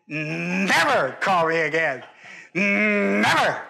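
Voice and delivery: drawn-out, growing NEVER of refusal; hammed-up, absolute brush-off; emphatic rejection. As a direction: lean long on the N of never, then burst out. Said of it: "And you think to your mind".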